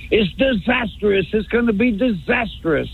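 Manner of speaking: high-pitched mock doom-saying; alarmed pundit imitation. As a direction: imitate a pundit crying disaster, voice lifted. Mimics a doom-saying commentator.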